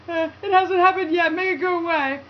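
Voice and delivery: high, loud panicked plea; freaked out. Retold or imitated. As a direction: squeal it in a panic. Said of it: "I get all freaked out".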